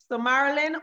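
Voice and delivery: usual speaking voice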